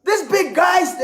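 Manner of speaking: high, incredulous exclamation; acted-out amazed reaction; mock disbelief. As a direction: pitch shoots up in comic astonishment. Acting out disbelief at the voice.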